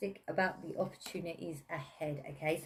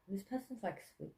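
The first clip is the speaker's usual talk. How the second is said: dropped almost to a whisper; hushed; 3 semitones higher, 12 dB quieter than usual. Real, not acted. Reader reading the card, hushed and soft. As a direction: say it softly, like a secret.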